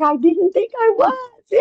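high-pitched imitation of a friend's drawn-out protest; mock protest. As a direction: stretch it out high, playfully imitating her excuse. Mock-retelling friend's protest about surprise birth.

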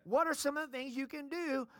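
normal talking voice

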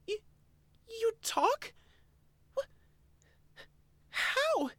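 high stammering squeal. Acted. Elaine stammering at a talking Oshawott, high frightened squeal. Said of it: "squealed out a question".

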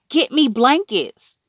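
high, frantic request; urgent plea. A narrator's reading. Mother sending Peter for blankets, frantic.

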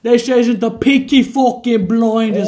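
put-on drawn-out hammy Peaky Blinders British accent; exaggerated British gangster impression. Drinker doing Peaky Blinders impression.

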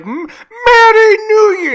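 very high, loud, drawn-out greeting; slow, whooping mock-foreign cheer; hammed-up foreign visitor. Eddie's character entering the train compartment, hammed-up foreign cheer. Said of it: "when Eddie comes in, he says".

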